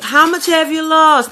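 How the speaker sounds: pry with bright curiosity; nosy lady. Retold or imitated. Cafeteria lady eagerly asking about her weight loss. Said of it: "And I just".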